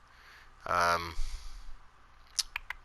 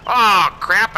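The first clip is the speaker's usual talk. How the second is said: shocked outburst; 9 semitones higher, 8 dB louder than usual. Real, not acted. Notices he sold wrong forks.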